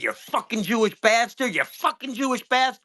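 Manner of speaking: mimicking a yell. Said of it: "and said".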